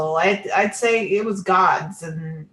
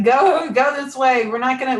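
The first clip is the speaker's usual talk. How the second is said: urgent pushing; 6 semitones higher, 5 dB louder than usual. Retold or imitated. Guides pushing her the right way, urgent. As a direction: imitate guides shoving someone onward.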